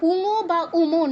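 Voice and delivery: উঙো and উমো as two syllables with rounded o; 'u-ngo', 'u-mo' as counterexamples; wrong Bengali reading. Shown as a demonstration. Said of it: "নয়".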